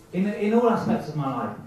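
ordinary talk

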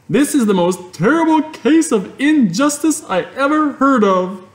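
put-on indignant girl's protest; Fern outraged. A narrator's reading. Fern cries injustice to her father, very high.

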